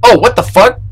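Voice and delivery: high startled exclamation. Real, not acted. Gamer finding a doll, startled. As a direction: blurt it out in alarm.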